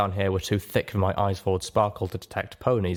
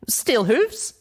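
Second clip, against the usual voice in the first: calling out high, unsure; high worried call; 3 dB louder than usual. Acted. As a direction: call into the dark room. Calling into dark basement.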